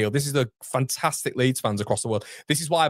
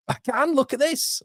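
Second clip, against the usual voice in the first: excited reveal. Real, not acted.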